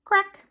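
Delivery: high sharp crack; egg crack sound. Shown as a demonstration. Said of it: "E, egg".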